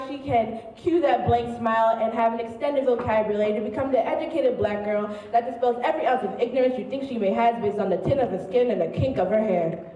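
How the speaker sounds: ordinary talk, nothing marked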